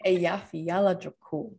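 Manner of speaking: Eyjafjallajökull as ay-YAH-fee-YAH-la-juh-kool; corrected pronunciation. Shown as a demonstration. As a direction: model Eyjafjallajökull in even, separate syllables. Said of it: "I mean, you're close".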